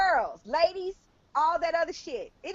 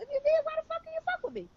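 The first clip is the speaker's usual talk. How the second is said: high mock outrage; 13 semitones higher, 3 dB quieter, faster than usual. Acting out accusing partner.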